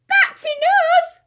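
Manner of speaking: high squealing protest. Acted. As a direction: squeal it like a piglet. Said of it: "squealed Tom".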